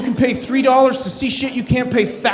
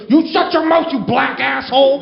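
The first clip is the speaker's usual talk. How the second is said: higher-pitched angry lady outburst; mock screaming insult; 3 semitones higher than usual. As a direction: hurl the insult like an enraged woman. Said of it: "they just started screaming at each other".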